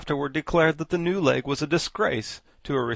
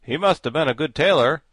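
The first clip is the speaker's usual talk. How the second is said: enviously; 3 semitones higher, 9 dB louder than usual. A narrator's reading. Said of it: "said the Scarecrow somewhat enviously".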